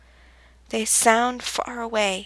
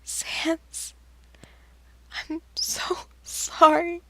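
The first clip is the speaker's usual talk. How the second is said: high, breathy whisper on the edge of crying; tearful whisper; 7 semitones higher, much slower than usual. Acted. Frisk sobbing an apology to Sans, whispered and high. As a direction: barely get the apology out through tears, up high.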